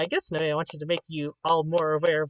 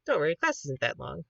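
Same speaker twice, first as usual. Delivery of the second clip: ordinary talk, nothing marked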